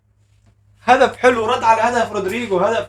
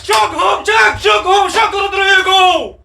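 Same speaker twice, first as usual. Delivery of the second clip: goal celebration; 9 semitones higher, slower than usual. Real, not acted. Fan celebrating Rodrygo's goal, high ecstatic shouting. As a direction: scream the striker's name in triumph.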